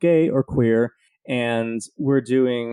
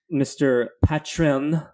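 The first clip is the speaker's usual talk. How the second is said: ordinary talk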